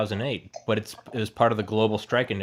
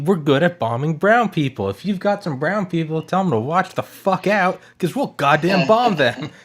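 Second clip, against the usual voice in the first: loud, higher ranting comedian; George Carlin bit; 7 semitones higher, 5 dB louder than usual. Retold or imitated. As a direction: belt it like a stand-up rant. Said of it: "George, George Carlin".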